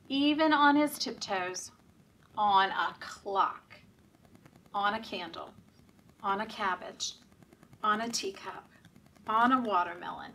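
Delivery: ordinary delivery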